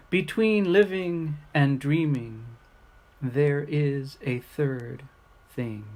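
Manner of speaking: poem reading. Acted. Reading short poems to the camera, unhurried and reflective. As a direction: read each line and let it settle. Reads poems aloud to viewers.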